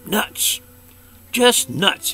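high startled cry of nuts; surprised outburst; surprised exclaim. A narrator's reading. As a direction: blurt it out in disbelief. Rabbit exclaims finding nuts.

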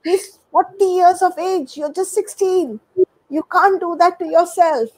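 high, disbelieving friend scolding; shocked friend. Retold or imitated. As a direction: act the shocked friend who can't believe it. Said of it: "your friends will come back and say".

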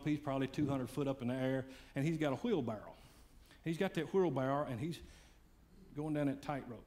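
usual speaking voice

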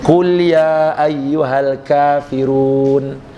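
slow, even Quranic chant; Quran recitation. Acted. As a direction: intone the verse slowly. (bacaan ayat perlahan)

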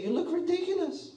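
high, taunting sneer; mocking onlookers. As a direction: tease him like a snickering bystander.

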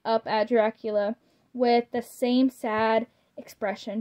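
ordinary delivery

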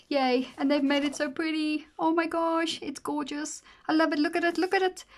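delighted squeal. Real, not acted. Gushing over her birthday month.

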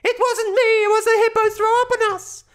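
put-on high, loud little boy protesting; child voice. A narrator's reading. Boy protesting innocence to dad, high loud child voice. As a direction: insist like a small boy caught out. Boy insisting to his father.

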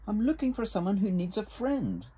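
high storybook character voice; little beaver. A narrator's reading. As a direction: speak up as an eager-to-help little beaver. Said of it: "said Little Beaver".

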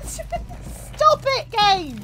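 high, loud plea to stop; frightened yell. Real, not acted. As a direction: shriek at the game after a scare. Yelling at game after a scare.

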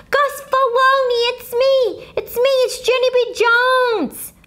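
put-on a kid hollering to get attention. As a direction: shout across the room to the janitor. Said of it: "I hollered out".

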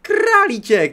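high, loud squeal: Králíček; excited squeal. Real, not acted. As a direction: shriek Králíček with delight. Spots a rabbit in game.

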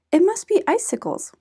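lightly voiced Mama Bear.

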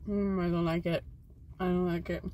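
chewing while talking; talking through a bite of candy; mouth full. Real, not acted. Seen 0: Both just bit candy; mouths still working while speaking, speaker unclear.

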